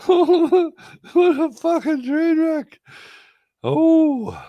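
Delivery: very high, loud drawn-out squeal; shrill disbelief. Real, not acted. Reacting to the train wreck, very high and loud.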